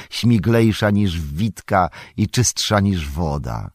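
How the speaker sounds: bouncy, rhythmic poem reading; lively verse recital. Acted.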